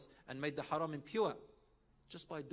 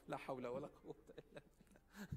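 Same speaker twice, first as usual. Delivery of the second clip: muttered formula; 11 dB quieter than usual. Real, not acted. Preacher mutters formula softly.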